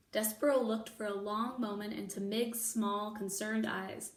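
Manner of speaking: plain reading voice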